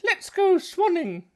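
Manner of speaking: very high, squeaky cartoon swan; high squeaky voice for Mister Swan; silly swan voice. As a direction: play a prim little swan. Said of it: "Swan, Swan".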